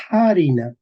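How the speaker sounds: harina with a strong breathy H at the start; aspirated H. Shown as a demonstration. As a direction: huff the first sound of harina, like English H. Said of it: "Ojo, se decía". (harina con la H aspirada)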